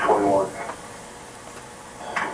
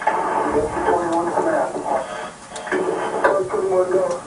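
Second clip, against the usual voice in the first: urgent radio shouting; 10 semitones higher, slower than usual. Real, not acted. Urgent fireground radio orders.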